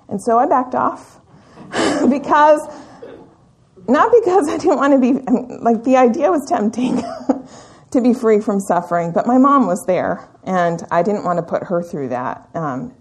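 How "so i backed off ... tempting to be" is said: rueful laugh. Real, not acted. Laughing at tempting idea of dying.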